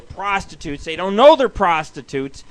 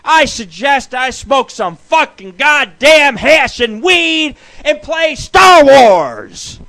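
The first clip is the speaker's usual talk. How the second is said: mock stoner yell; 8 semitones higher, 10 dB louder, much slower than usual. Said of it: "I was like".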